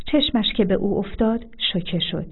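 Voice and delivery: ordinary delivery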